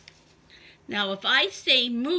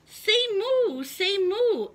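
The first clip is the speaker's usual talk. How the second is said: coaxing cow voice; 8 semitones higher, 4 dB louder than usual. Acted. Cow urging each animal.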